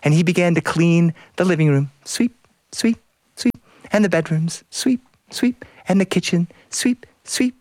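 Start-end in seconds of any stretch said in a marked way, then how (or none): 2.0-3.0 high, airy sweep sounds; sweeping noise. A narrator's reading. Husband cleaning the living room, high swishing sweeps. 4.7-5.6 high whispered broom strokes; whispered sweeping. A narrator's reading. Husband cleaning the bedrooms, whispered sweeps. 6.7-7.6 high whispered broom strokes; whispered sweeping. A narrator's reading. Husband cleaning the bedrooms, whispered sweeps.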